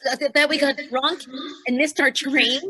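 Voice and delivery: loud panicked stammer; alarmed outburst. Acted. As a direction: blurt it out loudly. Panicking friend.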